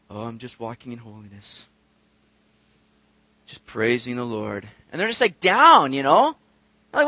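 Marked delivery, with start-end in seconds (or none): 0.1-4.7 low, soft, droopy holy voice; mock gloomy piety. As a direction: sigh it out like a martyr.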